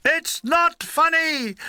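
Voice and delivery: high, slow, drawn-out colonel's shout; stretched-out, high-pitched protest; indignant shout. A narrator's reading. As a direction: shout it as an outraged officer. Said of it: "rose to his feet and shouted".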